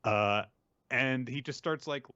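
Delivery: normal talking voice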